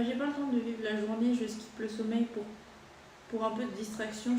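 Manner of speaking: ordinary talk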